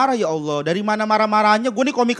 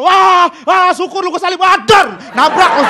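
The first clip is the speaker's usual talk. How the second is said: mock shout; 6 semitones higher, 4 dB louder, slower than usual. Teen taunting friend while overtaking.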